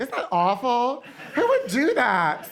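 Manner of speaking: high, drawn-out mock horror; feigned concern. Feigning concern as the secret culprit.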